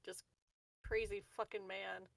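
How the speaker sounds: usual speaking voice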